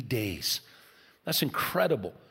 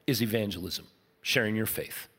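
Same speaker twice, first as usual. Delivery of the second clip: hushed emphasis; 8 semitones lower, 7 dB quieter than usual. Real, not acted. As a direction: drop to a low, soft voice to land the point. Key point said hushed.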